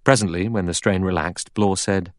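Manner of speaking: ordinary delivery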